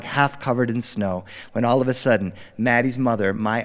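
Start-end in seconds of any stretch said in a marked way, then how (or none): none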